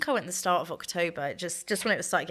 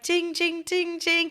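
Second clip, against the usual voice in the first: high sung ding-dong of a plane chime; imitating the PA chime before a prank announcement; mock chime; 11 semitones higher, slower than usual. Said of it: "it connects, and it's like".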